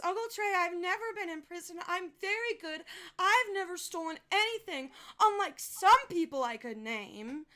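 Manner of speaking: lightly voiced little girl, prim and a bit smug; a hint of a proud child correcting her uncle. Niece answers her uncle.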